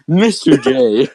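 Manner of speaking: saying the name through laughter; laughing. Real, not acted. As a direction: laugh it out slowly.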